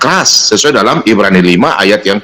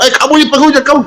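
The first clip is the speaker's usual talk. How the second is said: high-pitched mock scolding of a visitor; mock rude accusation; 13 semitones higher, much faster than usual. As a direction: play the rude preacher insulting a guest. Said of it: "Nggak mungkin saya bilang".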